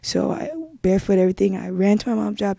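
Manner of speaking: ordinary talk, nothing marked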